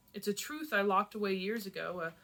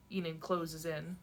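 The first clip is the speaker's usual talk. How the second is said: ordinary delivery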